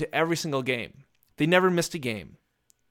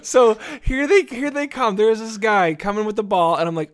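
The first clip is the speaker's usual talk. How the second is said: normal talking voice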